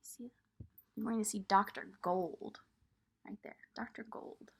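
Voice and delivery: soft voice showing the picture; hushed aside. Real, not acted. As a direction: point out the doctor quietly. Aside to child about picture.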